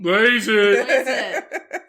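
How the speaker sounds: loud, high mock cheer; playful yelled interjection; joking shout. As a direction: holler it as a silly catchphrase. Joke catchphrase after phone number.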